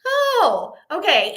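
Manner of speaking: high, bright-pitched polite "oh"; feigned calm acceptance. Retold or imitated. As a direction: take it in as if unbothered. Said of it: "trying to act really cool and calm".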